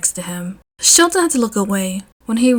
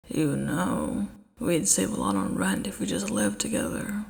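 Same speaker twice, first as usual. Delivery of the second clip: softer, low deadpan; lightly voiced low Eraser; quiet Eraser voice; 4 semitones lower, 10 dB quieter than usual. A narrator's reading. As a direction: read the fanfic line flat and low.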